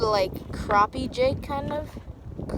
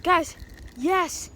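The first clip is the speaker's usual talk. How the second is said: excited; 5 semitones higher than usual. Real, not acted. Landing a fish, cheering.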